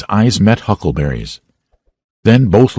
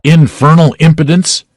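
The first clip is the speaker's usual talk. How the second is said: indignant remark; 9 semitones higher, 4 dB louder than usual. A narrator's reading. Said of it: "said a bystander".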